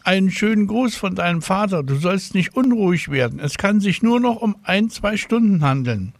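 high-pitched voice of a caller; woman on the telephone. A narrator's reading. Hospital caller passing on Father's greeting, high phone voice.

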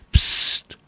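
unvoiced shush; a sharp whispered psst. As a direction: hush the mother, voiceless. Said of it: "macht Papa Drache".

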